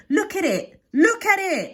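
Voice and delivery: high, loud shriek of alarm; frantic cry. A narrator's reading. Mayor of Edinburgh seeing Nessie, frantic cry. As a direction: shout it out, nearly having a fit. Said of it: "he cried and fainted".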